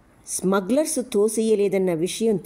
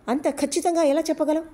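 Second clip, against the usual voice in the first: much higher, exasperated question; fed up and angry, pushing back; irritated anger; 11 semitones higher than usual. Acted. Ravi challenging the smuggler, irritated and angry. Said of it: "విసుగు, కోపం ధ్వనిస్తున్న గొంతుతో".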